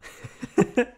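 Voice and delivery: a burst of high, delighted laughter; giddy laugh. Acted. Girlfriend hearing they're together, giddy laugh. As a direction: laugh out loud with relief and joy. Overjoyed reaction in the scene.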